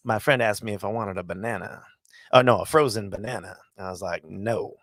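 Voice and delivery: low, soft, offhand comedian impression; Mitch Hedberg. Fan retells a banana joke, Mitch Hedberg voice. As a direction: tell one-liners low and understated. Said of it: "he talked like that".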